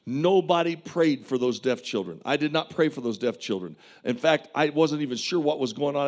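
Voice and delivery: usual speaking voice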